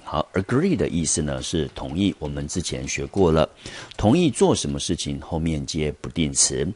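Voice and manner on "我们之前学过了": normal talking voice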